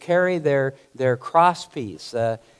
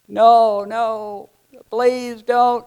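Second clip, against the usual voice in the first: higher, pleading voice of a dragged prisoner; pleading cry; 9 semitones higher, 6 dB louder, much slower than usual. Acted. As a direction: beg them as they drag you away. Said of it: "screaming, crying".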